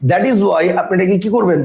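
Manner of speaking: ordinary talk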